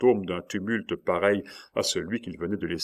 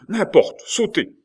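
high, loud command; frantic insistence; 12 semitones higher, 7 dB louder, slower than usual. A narrator's reading. Nurse urging her master to jump, frantic insistence. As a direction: brush off the objection and urge him on. Nurse overrides his objection.

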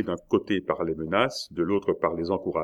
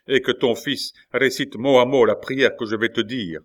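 loud, raised instruction; commanding; 5 semitones higher, 5 dB louder than usual. A narrator's reading. Attacker ordering the father to have son pray, commanding. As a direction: order with authority, pitched up. Attacker dictates terms of the prayer.

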